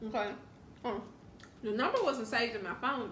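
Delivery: talking between bites; speaking while chewing. Real, not acted. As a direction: mouth partly full. Seen 1: bites broccoli at start, then talks while chewing it.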